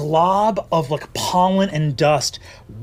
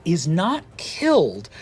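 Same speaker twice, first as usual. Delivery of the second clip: ordinary talk, nothing marked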